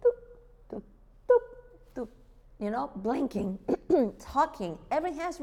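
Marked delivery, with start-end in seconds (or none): 0.0-2.1 evenly spaced tuk, tuk, tuk, tuk; rhythmic tuk beats. Shown as a demonstration. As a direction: tap out a steady beat with the voice. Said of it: "God created us with rhythm".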